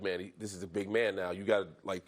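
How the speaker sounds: low, gruff coach voice; coach's low warning. Retold or imitated. O-line coach warning the rookie about a big man, low. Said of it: "he was just like".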